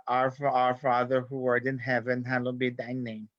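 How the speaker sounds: viejita's South Texas accented English. Shown as a demonstration. Viejitas saying the rosary at velorios, South Texas accent. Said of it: "wicked South Texas".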